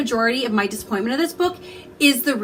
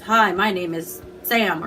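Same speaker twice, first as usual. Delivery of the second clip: robotic speech device; 5 semitones lower, slower than usual. Retold or imitated. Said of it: "one of those little machines that says".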